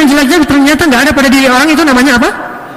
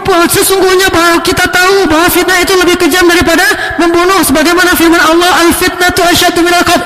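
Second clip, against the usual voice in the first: high, pompous pulpit orator voice; mimicking a lecturer on stage, grandly preaching; mocking podium preacher; 7 semitones higher than usual. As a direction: ham up a preacher declaiming from the podium. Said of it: "Anehnya di atas podium".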